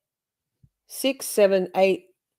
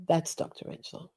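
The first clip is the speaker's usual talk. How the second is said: whispered intro; 10 dB quieter than usual. Shown as a demonstration. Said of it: "And this is Doctor Rentschler".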